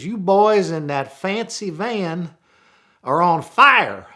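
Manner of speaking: slow, high Southern trucker warning over the CB; Southern accent. Retold or imitated. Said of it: "my best Southern accent now".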